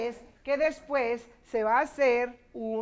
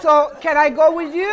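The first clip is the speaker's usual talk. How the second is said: loud, higher, breaking into laughter; amused joking; 4 semitones higher, 11 dB louder than usual. Real, not acted. Teacher jokes about student's drinking sentence, loud and amused. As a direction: tease loudly, about to burst out laughing.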